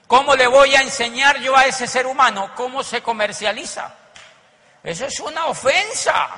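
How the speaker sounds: preacher scorning the idea of teaching a master; indignant preaching. Acted. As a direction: call it an insult to the room.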